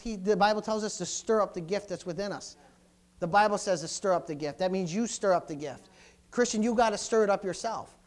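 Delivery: normal talking voice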